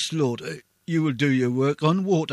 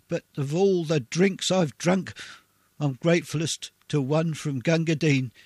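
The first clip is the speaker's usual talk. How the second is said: usual speaking voice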